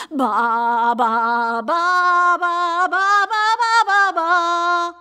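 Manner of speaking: loud singsong baas climbing in pitch; bleating lamb. Acted. Dawdling lamb bleats along.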